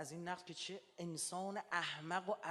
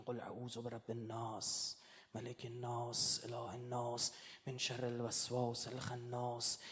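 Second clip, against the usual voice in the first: slow, low chanted recitation of Surah An-Nas; low, measured Quranic recitation; 6 semitones lower, 4 dB quieter, slower than usual. Acted. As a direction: recite the verses slowly and solemnly, lower in pitch.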